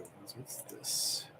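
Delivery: curious hushed whisper. Real, not acted. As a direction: whisper it to yourself. Muttering to self on stream.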